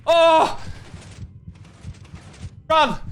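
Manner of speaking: sharp high startled cry; startled yelp. Real, not acted. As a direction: jump at a sudden scare. Sudden scare in game.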